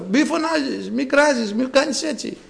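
high-pitched mock hushing; imitated scolding. Crowd hushing the crying Bartimaeus.

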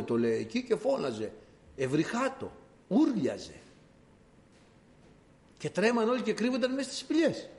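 normal talking voice

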